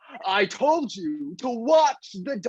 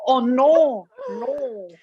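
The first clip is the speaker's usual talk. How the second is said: horrified; 4 semitones higher, 4 dB louder, slower than usual. Acted. Father reacts in horror.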